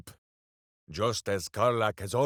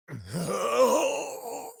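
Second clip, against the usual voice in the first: big hearty laugh; 9 semitones higher than usual. Acted. Laughing at his hamster.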